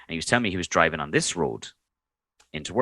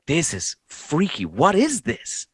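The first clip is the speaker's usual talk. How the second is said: high, drawn-out retold bewilderment; slow, pitched-up mild alarm; mock freaked-out; 10 semitones higher, slower than usual. Retelling his bafflement at strange website.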